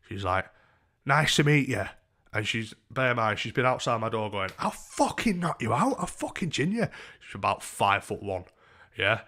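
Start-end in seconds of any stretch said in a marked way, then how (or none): 1.0-1.8 higher, louder hello; imitated woman's greeting. Woman greeting him as door opens, imitated. 4.6-6.9 put-on threatening drunk woman. Tiny woman threatening him outside his door, belligerent.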